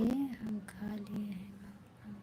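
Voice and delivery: slow, whispered read-out; reading under breath. Real, not acted. Streamer reading a viewer's reply about eating, in a slow whisper. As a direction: whisper the message slowly to yourself.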